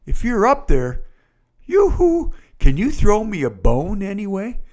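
high, half-joking call up to heaven, mocking a desperate prayer; yoo-hoo sung out high, like calling a neighbour; casual cry to God. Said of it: "and you cry out to God".

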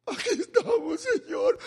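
high, crying put-on voice; mock whiny plea. Mocking people who come to God last, high whiny crying voice. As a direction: mimic someone who only prays when desperate.

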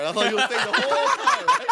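high, loud, laughing voice; helpless laughter through the words. Real, not acted. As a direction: crack up while telling it. Laughing at own retold yell.

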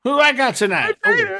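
high, loud, anxious prelim wrestler; nervous jobber. Retold or imitated. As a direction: squeak it out like a scared jobber. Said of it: "role-play of prelim wrestlers".

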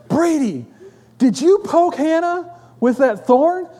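high, slow, indignant interrogation; accusing father. Retold or imitated. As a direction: confront your son in outraged disbelief. Father confronts son after scream.

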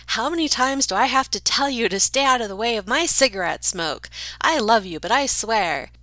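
hammed-up yell of an exasperated mother; imitating a neighbor mom yelling at her little girl; mock yelling mom. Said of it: "the mom was yelling".

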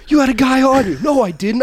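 high, loud defensive retort; answering back. Retold or imitated. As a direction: defend yourself against your brother. Brother answering back in argument.

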